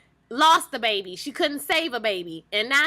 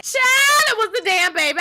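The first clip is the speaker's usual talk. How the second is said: high, loud disbelief; stunned exclamation; 9 semitones higher, 6 dB louder than usual. Real, not acted. Vlogger telling fans, still shocked by the scene. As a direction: blurt it out in shock. Reacting to the baby on screen.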